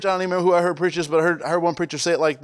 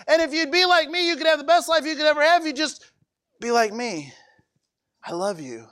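raised, tender fatherly voice; the Lord speaking tenderly; 8 semitones higher than usual. Acted. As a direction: gently plead with a wayward son.

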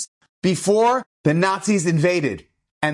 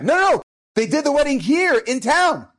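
much higher, urgent blurting of the news; excited news; 10 semitones higher than usual. Retold or imitated. Messenger blurting the news to the rabbi, high and excited. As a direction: rush it out, high and breathless with the news.

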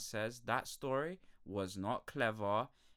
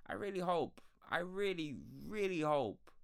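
raised, pleading repeated hope; high pleading; 8 semitones higher than usual. Real, not acted. Host pleading to listeners about pretentious people. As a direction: plead it high, really wishing.

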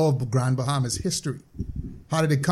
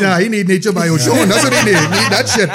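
laughing; 6 semitones higher, 8 dB louder than usual. Real, not acted. Laughing about skin products.

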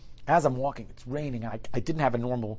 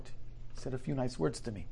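ordinary talk, nothing marked

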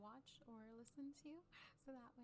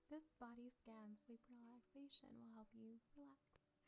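soft, unhurried body-scan voice with long pauses; hushed relaxation guide, leaving space between phrases; guided sleep meditation; 4 dB quieter than usual. Acted.

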